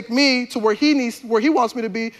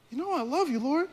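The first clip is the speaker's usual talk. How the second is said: higher, soft-voiced insistence; pleading reassurance; 5 semitones higher, 7 dB quieter than usual. Peter to Jesus asking again, pleading reassurance. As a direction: insist gently, voice lifting.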